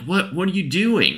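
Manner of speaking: high-pitched mock outrage; incredulous protest. Neighbors coming out to stop an eviction, incredulous protest.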